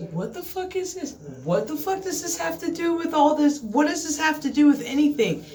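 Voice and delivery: hammed-up confusion; mock bewildered viewer. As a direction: act baffled at the episode. Imitating viewer's confused reaction.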